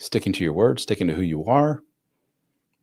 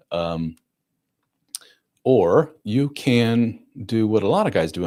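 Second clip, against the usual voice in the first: ordinary talk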